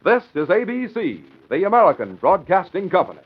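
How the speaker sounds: bright network station ID; announcer read. Acted. Station announcer identifying the network. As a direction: announce it crisply to listeners.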